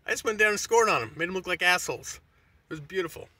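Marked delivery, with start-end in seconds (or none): none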